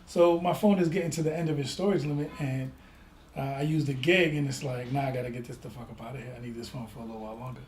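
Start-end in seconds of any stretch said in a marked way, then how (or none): 4.9-7.6 hurried inner thought. Retold or imitated. Voicing own thoughts about phone.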